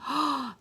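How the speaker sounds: quick, high intake of breath; mock-alarmed gasp; shocked gasp. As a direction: gasp for a young listener. Reacting to the wolf's trick.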